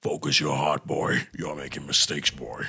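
hammed-up stern god addressing his son; Kratos voice. Holds Kratos voice.

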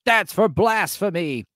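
lightly hammed-up divine verdict; mock voice of God. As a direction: deliver it slowly like God passing judgment. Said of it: "God will be like".

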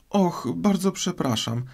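put-on high, flustered girl's apology; high-pitched, regretful exclamation; exclaimed apology. A narrator's reading. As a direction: catch yourself and cry out sorry. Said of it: "zawołała znów Alicja".

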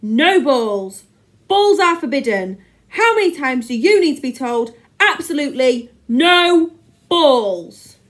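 loud, exasperated rant; angry telling-off. A narrator's reading. Teacher reading out his playground ban, shouting angrily. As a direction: lay down the law loudly, stretching the end.

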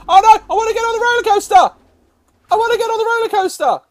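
put-on high, rushed, childlike wail; whining plea. Giraffe desperate to get on the roller coaster, whining plea.

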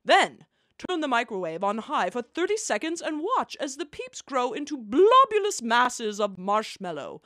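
ordinary talk, nothing marked

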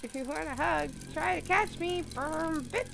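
high, singsong teasing voice; playful character voice. Acted. Character teases the player to catch him, high and singsong. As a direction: tease like a hide-and-seek child. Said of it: "That's him talking".

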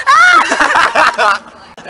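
high loud burst of laughter; cracking up loudly. Real, not acted. Student cracks up telling friends the story, loud high laugh.

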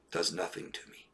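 flat voiceless whisper of an unfeeling person; cold whisper. Retold or imitated. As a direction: whisper it with no feeling at all. Voicing the emotionless psychopath.

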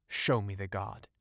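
usual speaking voice

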